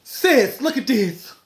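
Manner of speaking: high, loud excited squeal to her sister; excited high call. Retold or imitated. Shrieking at her sister to look at the phone. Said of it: "I was like".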